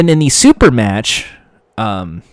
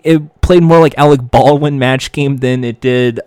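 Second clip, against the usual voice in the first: ordinary talk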